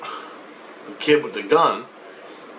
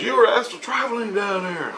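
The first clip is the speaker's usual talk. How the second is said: high-pitched imitation of a wife; mock wife's insistent explanation, voice raised; wife explaining; 9 semitones higher than usual. Wife explains to confused husband, high insistent voice. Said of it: "she goes".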